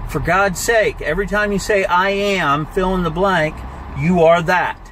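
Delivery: ordinary talk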